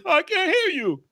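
very high squeaky frog voice; deaf frog. Acted. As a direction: frog who can't hear a word. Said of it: "He says".

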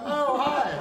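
high, loud mock-innocent hello; retold greeting, blurted loud and high as if caught red-handed. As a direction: act out being caught, greeting far too loudly. Caught trying to steal plates.

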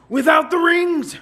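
high, loud indignant shout; mock shout. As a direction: bark out the outrage. Price outrage.

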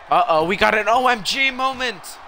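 high, excited announcer-like build; hyped play-by-play. Real, not acted. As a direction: build hype toward the big dive. Builds up to a big dive.

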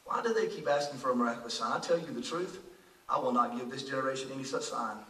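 very soft, low and grave; sighing, voiced as Jesus. Jesus refusing this generation a sign, hushed and low. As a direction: quote it quietly, deep in the voice. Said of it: "He sighed deeply in his spirit and said".

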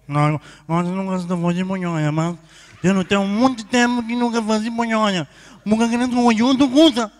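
comic honking refusal; nasal speech impediment. Acted. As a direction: explain through the nose, building to loud. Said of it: "me fanhoso também".

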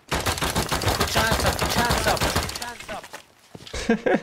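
high shouted hands up; shouting high. Pretend. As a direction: yell it high and loud, slowly. Chasing a naked player in game.

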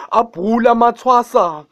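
ordinary talk

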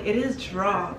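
DRAWER as "draw", r dropped, softly; New York drawer. Retold or imitated. Repeating it back to the vlog, DRAWER said as "draw". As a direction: quietly insist DRAWER is "draw". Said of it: "I repeated it".

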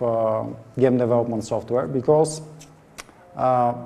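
ordinary talk

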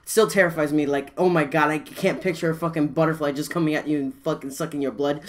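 ordinary talk

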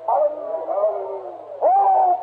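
rising hallelujah. Real, not acted. Preacher to his congregation, cries out in rising fervour.

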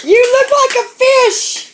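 high excited tease. Real, not acted. Teasing repeated higher, louder.

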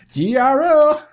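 high, loud hammed-up spelling; exaggerated call-out. Player repeating the rescue group's name, high and loud. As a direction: belt out the letters for laughs.

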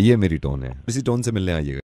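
deep put-on voice. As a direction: sign off the prank in a deep, quiet voice.

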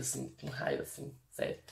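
hushed, voiceless aside; whispered confession. Real, not acted. Admitting envy of the local women, whispered. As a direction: whisper it like a guilty secret.

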